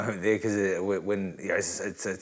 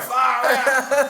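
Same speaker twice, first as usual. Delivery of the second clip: high, loud shock; shouted double-take; stunned outburst; 13 semitones higher, 6 dB louder than usual. Retold or imitated. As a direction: cry it out in amazed disbelief. Retelling his shock at what he saw.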